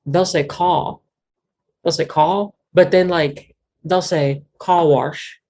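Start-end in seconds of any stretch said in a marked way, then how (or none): 0.4-1.0 "call" for car, with no R; dropped R. Shown as a demonstration. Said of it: "they drop that R a lot". 2.0-2.5 car said R-less, as "call"; dropped R. Shown as a demonstration. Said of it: "take the R off of car". 4.6-5.4 "call wash" as R-less car plus "warsh"; slow "call wash", R dropped from car and added inside wash; R moved into wash. Shown as a demonstration. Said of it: "put the R inside of wash".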